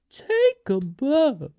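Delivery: grand tribute. Pretend. Hailing Florence Pugh with a drawn-out, high, grand tribute. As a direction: announce it slowly like a showman's finale.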